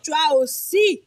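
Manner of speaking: slow the second half, pleading like the other part of you; playful imitation of a needy inner voice; mock whiny voice. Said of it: "c'est le zéro virgule quatre-vingt-dix-neuf pour cent qui a dit".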